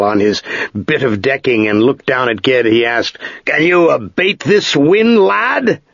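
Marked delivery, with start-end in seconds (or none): 3.4-5.8 slow, higher questioning sea captain; gruff ship master. A narrator's reading. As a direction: ask like a stout ship's master in a storm.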